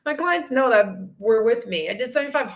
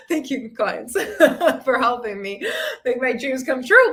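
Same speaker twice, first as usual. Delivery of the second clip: laugh-broken mock gratitude; teasing thank-you speech dissolving into laughter. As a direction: thank them while giggling, half tearful.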